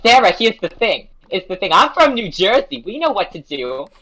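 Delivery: high, loud imitation of a drunk friend; tipsy roommate. Drunk roommate advises sad friend, high and insistent. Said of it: "like, giggling".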